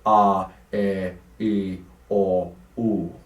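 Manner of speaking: "A, E, I, O, U" sung as open, rounded Italian vowels with the jaw dropped. Shown as a demonstration. Voice teacher to choir students, demonstrating open rounded Italian vowels. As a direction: round the mouth and drop the jaw on each vowel. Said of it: "with Italian vowels, we have".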